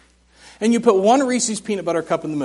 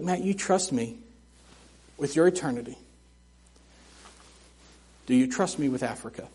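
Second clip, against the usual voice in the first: soft, hushed voice of God, lower and quieter; still small voice; 8 semitones lower, 11 dB quieter than usual. Retold or imitated. God quietly questions the preacher's trust: hushed and low. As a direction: speak as God, gently and softly. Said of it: "in that still small voice".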